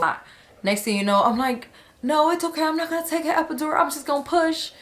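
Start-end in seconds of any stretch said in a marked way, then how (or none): 2.0-4.7 determined. Retold or imitated. Deciding to skip epidural, high fast.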